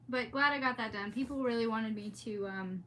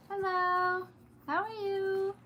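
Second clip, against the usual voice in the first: high, louder sing-song hello to the dog; pet greeting; 8 semitones higher, 6 dB louder than usual. Real, not acted. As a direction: greet the puppy brightly.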